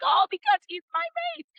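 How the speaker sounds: very high, hammed-up squeal; mock-giddy falsetto; gushing realisation. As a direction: squeak it out like a swooning heroine. Acted gushing sudden realisation.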